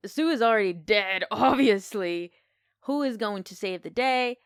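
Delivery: loud, mildly hammed-up suspense; worked-up retelling of a film climax; gripped moviegoer. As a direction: retell the panic with punched volume. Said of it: "And I'm like".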